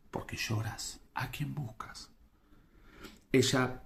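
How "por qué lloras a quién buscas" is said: soft, half-whispered questioning; much quieter, almost whispered; gentle hushed questions. Acted. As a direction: ask softly, as if consoling someone weeping. Jesus' questions in scripture reading.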